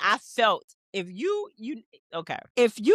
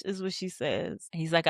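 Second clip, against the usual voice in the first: normal talking voice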